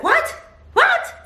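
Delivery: high, loud yelp of disbelief; squealed retold shock with a laugh; imitated shocked scream. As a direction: mimic a stunned husband screaming. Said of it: "he starts screaming".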